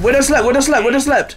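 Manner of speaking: high, loud quick callout; combat callout. Real, not acted. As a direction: shout the kill to the team. Calling enemy kill.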